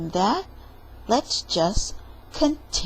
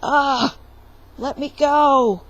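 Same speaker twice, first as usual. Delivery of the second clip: high, loud cry to be let go; frightened plea; 5 semitones higher, 7 dB louder than usual. Acted. Jack grabbed by the giant, crying out. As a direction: struggle and plead.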